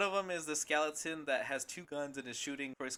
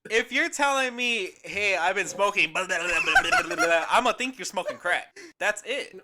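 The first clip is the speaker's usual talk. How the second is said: loud, high, half-laughing rant; emphatic arguing; 5 semitones higher, 10 dB louder than usual. Real, not acted. Arguing own logic to co-hosts, loud and emphatic. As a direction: argue it loudly, tickled by your own point.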